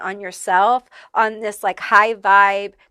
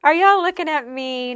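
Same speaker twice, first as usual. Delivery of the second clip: higher, playfully feigned innocence; a teasing what, me; mock innocent surprise; 6 semitones higher, 3 dB louder than usual. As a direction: act coy as if caught off guard by the attention. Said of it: "I feel this energy of, like".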